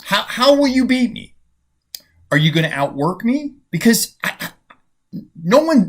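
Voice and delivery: fierce competitive taunt; intense challenge. Retold or imitated. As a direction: fire the questions at him like a rival who won't lose. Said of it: "And he said".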